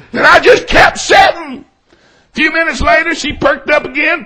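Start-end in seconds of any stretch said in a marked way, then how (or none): none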